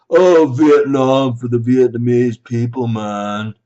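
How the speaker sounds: loud stoner-hippie mockery; mocked hippie protester. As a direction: imitate a hippie sloganeer, loudly. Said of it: "they'd say things like".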